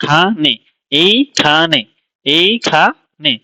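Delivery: very slow খানে and এইখানে, gaps between এই, খা, নে; syllables not joined. Shown as a demonstration. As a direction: break এইখানে into separate syllables. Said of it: "আসলে এটা একসাথে না".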